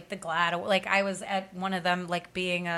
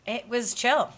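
usual speaking voice

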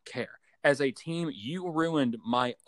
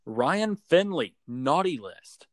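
slow, weighty announcement; dramatic reveal; 2 semitones higher, 3 dB louder, slower than usual. Real, not acted. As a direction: draw out the verdict slowly. Dramatic naughty list reveal.

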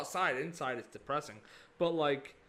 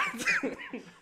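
squealing high-pitched laughter; helpless shrill cackle; 4 dB louder than usual. Real, not acted. Telling friends the story, he loses it in laughter.

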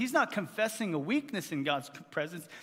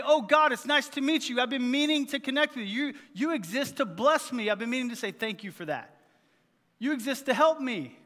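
higher, louder, breezily self-centred; mock chummy prayer; 5 semitones higher, 5 dB louder than usual. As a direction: parody someone casually greeting God. Parody of a self-centred greeting to God.

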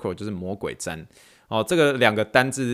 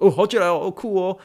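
higher, louder teasing delight; playful exaggerated cheer; 9 semitones higher, 5 dB louder than usual. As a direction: tease the patient with big mock joy. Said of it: "对美国人我都可以这样".

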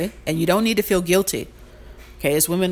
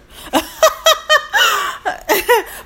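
high squealing laugh at her own joke; high delighted laugh; 5 dB louder than usual. Real, not acted. Laughing at her own good line, a high delighted squeal. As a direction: burst out laughing, pleased with herself.

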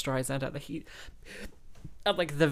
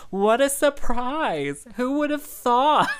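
high, slow, loud sarcastic gasp; mock surprise; 9 semitones higher, 8 dB louder, slower than usual. Retelling [name]'s cameo, hammed-up fake surprise, high and slow. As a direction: ham up fake astonishment, laughing. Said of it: "it's so convincing that it's like".

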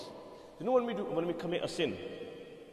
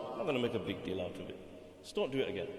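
quiet forgiving boss; 8 semitones lower, 8 dB quieter, much faster than usual. Retold or imitated.

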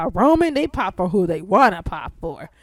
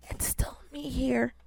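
soft breathy whisper, no voice; hushed aside to listeners, half joking; 9 dB quieter than usual. Real, not acted. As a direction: lean in and confide it.

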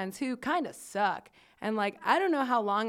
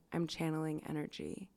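hushed mystic; 4 semitones lower, 9 dB quieter than usual. Retold or imitated. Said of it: "A S M R".

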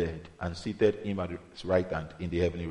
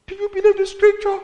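very high, loud squeal of a question; high-pitched challenge; 9 dB louder than usual. Real, not acted. Preacher challenges believers on the scripture, high-pitched challenge. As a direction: fling the question up high at the listeners. Challenges congregation at sermon's peak.

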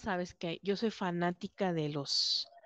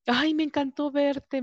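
delighted gushing; 8 semitones higher, 4 dB louder, slower than usual. Retold or imitated. Neighbor's delighted farewell quoted.

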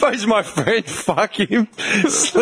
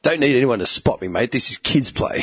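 low, cocky Aussie gym bloke; 9 semitones lower than usual. Pretend. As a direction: brag like a bogan lifter. Imitating gym bro boasting.

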